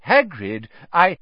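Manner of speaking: mild try at cutting in; restrained, unfinished interjection; attempted interruption. A narrator's reading. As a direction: try to get a word in, then break off. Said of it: "Dumbledore tried to say something".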